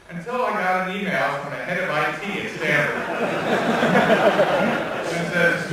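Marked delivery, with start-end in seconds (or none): 3.0-5.1 loud laugh. Real, not acted. Laughing at the spam email story.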